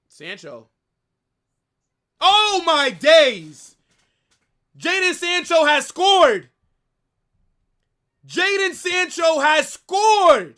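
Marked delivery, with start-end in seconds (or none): 2.2-10.5 drawn-out screaming goal call; long goal yell. Real, not acted. Streamer celebrates Sancho goal.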